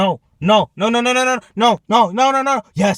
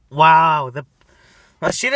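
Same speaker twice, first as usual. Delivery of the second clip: ordinary talk, nothing marked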